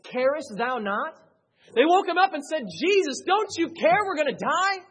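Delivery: high, loud, accusing cry; a loud high desperate plea to Jesus. Retold or imitated. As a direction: plead as if ignored in danger. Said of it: "they say".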